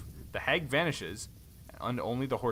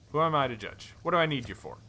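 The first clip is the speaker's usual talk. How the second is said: usual speaking voice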